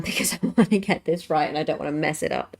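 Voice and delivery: ordinary talk, nothing marked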